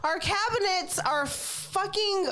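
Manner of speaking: high, slow exasperated complaint; exasperated rant. Real, not acted. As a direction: vent about the wrecked kitchen. Ranting about the destructive pig.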